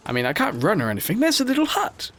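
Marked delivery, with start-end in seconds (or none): 1.2-2.1 excited squeal. Real, not acted. Excited discovery of hut.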